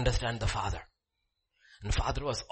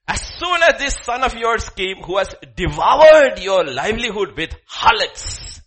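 high, loud accusing tone; resentful outburst; 8 semitones higher, 12 dB louder than usual. Acted. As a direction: spit out the accusation. Voicing resentful elder son.

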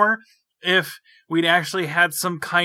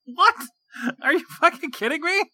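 high-pitched, exasperated disbelief; mock incredulous; 11 semitones higher than usual. Viewer reacting to the professor's sudden conclusion, incredulous. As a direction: question it in high, outraged disbelief. Acts out disbelief at plot jump.

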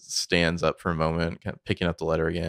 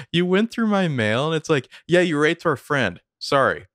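mock confrontation; 8 semitones higher, 6 dB louder than usual. Hammed-up retelling of Mona's reply.

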